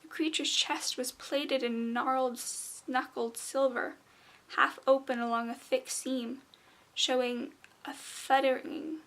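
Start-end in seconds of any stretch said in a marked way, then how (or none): none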